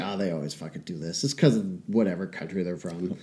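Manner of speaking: quiet, dismissive retold remark; offhand explanation. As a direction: mutter it like it's obvious. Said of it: "So I was like".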